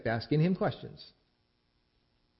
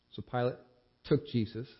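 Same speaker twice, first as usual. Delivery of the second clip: ordinary delivery, nothing marked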